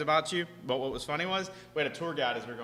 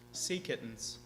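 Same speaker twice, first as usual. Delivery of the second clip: ordinary talk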